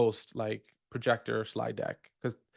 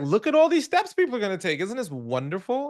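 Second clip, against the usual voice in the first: excited; 7 semitones higher, 5 dB louder than usual. Pretend. Imagined gushing to audience.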